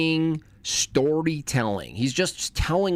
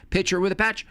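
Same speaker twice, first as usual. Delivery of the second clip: "Picture with a patch" said higher; higher, brighter take; 8 semitones higher than usual. Shown as a demonstration. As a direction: lift the pitch up on "Picture with a patch". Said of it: "Picture with a patch, not with a patch".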